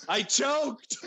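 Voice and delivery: mock-despairing little wail; comic dismay. Real, not acted. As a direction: lament it with rising dismay.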